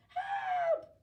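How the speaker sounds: high, loud cry for help; panicked shout. Acted. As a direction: yelp as if blinded by light. Toad pushed out into sun.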